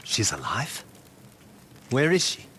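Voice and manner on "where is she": sharp, rising question, much higher; jolted into asking fast; urgent surprise. Acted. Takeshi demanding news of Yuna, urgent surprise. Hunter hears Yuna is alive.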